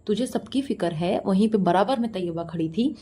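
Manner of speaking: ordinary talk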